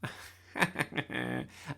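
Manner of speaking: loud mocking chuckle; sneering laugh. A narrator's reading. Nick laughing at Bertie's claim, sneering. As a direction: snigger at the joke. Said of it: "sneered Nick".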